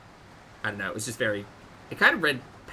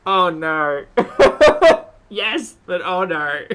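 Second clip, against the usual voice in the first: laughing; 5 semitones higher, 11 dB louder, slower than usual. Real, not acted. Laughing mock dismay.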